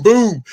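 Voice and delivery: punch sound. Retold or imitated. Imitating a punch.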